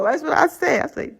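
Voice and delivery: normal talking voice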